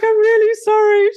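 high, loud apology half crying, half laughing; retold weepy sorry, cracking up; tearful apology. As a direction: squeak it out through tears, replaying the moment. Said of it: "I'm like".

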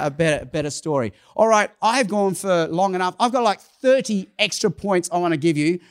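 ordinary talk, nothing marked

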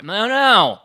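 loud, high-pitched "no"; outraged high, loud protest; emphatic refusal. Real, not acted. Rejects the dog owner's excuse.

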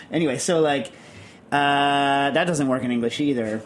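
normal talking voice

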